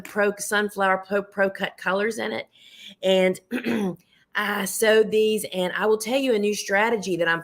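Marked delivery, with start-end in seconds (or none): 3.5-3.9 throat clearing. Real, not acted. Hoarse host clears throat.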